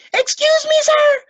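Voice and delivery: high, loud polite plea; boy interrupting. A narrator's reading. Boy trying to stop the coach, high and urgent. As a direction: urgently get the coach's attention. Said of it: "said Lois".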